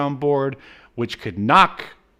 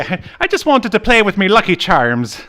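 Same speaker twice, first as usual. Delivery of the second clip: higher, louder hammed-up Irish accent; mock Irish accent; 8 semitones higher, 7 dB louder than usual. Irish host joking as Dolph, mock Irish accent. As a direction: overplay a stage Irish voice. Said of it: "Thank you, racist".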